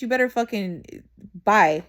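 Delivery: ordinary talk, nothing marked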